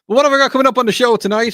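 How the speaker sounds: breathless after running; out of breath. Real, not acted. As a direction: talk while still catching breath. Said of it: "I'm a little bit out of breath".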